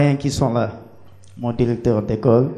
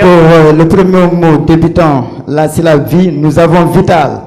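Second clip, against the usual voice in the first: eager answer; 5 semitones higher, 9 dB louder than usual. Real, not acted. Candidate announces answers to jury.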